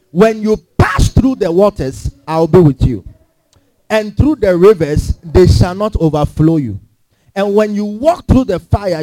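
loud, slow, measured reading of the verse; a slow, forceful public reading of the verse; slow scripture reading. Acted. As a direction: proclaim each line slowly to the church. Congregation reads scripture aloud together.

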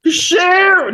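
high yelled call; shout. Acted. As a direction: bellow out the title. Galloping into sleeping camp.

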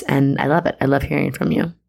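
ordinary delivery

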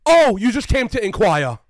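mock the busybody; slow, loud sneering mimicry. Mocking the bystander.

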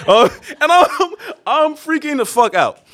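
very high, laughing exclamation; laughing panic recap. Real, not acted. Teller recalling his panic in the club, laughing high. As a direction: laugh while squeaking out the panic.

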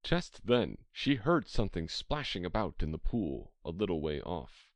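ordinary delivery

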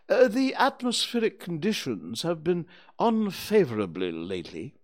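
high, loud owl character voice; pompous Owl. Acted. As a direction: pronounce it like a self-important owl. Owl figure speaking pompously in story.

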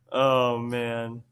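ordinary talk, nothing marked

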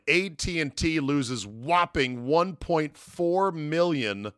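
slow, deliberate headline voice; announcer-style headline reading. Shown as a demonstration. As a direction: read it like a news banner.

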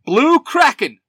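high, forceful hero shout; yelled morph call. A narrator's reading. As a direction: yell it like a transformation call. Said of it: "Billy yells".

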